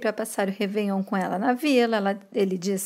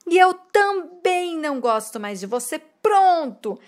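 higher, a mild hammed-up angry outburst; mock furious; 7 semitones higher than usual. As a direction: play the angry father in a retelling. (pai furioso, imitado e mais agudo)

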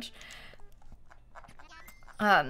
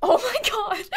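sudden high startled cry; surprised cry; 9 semitones higher than usual. Real, not acted. Streamer startled by a chat message, high cry. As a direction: blurt it out, caught off guard.

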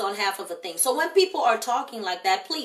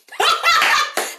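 loud high laugh; 9 dB louder than usual. Real, not acted. Laughing loudly at a viewer's comment, delighted.

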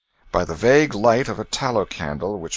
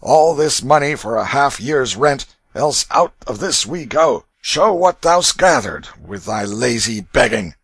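loud, raised ruffian's scolding; angry demand; 5 semitones higher, 7 dB louder than usual. A narrator's reading. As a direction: demand the money like an angry bully. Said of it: "He turned angrily upon the prince".

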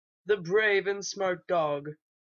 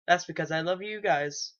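normal talking voice

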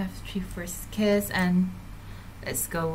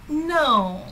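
higher, louder startled no; sudden dismayed no; 8 semitones higher, 5 dB louder than usual. Real, not acted. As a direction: blurt out a shocked no. Sim refuses her.

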